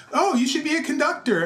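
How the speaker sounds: high, bright imitation of the teacher; teacher quote. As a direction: lift the voice high for her praise.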